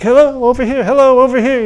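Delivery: high sing-song calling out; hawking vendors calling. Retold or imitated. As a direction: call out like a pushy vendor.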